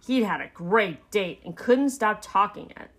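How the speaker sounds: plain reading voice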